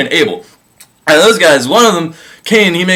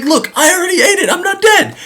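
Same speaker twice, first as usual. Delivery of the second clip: much higher, giggly and dismissive; breezy with a laugh, higher voice; laughing Eve. Retold or imitated. Eve brushing off God's warning to Adam, high and giggly.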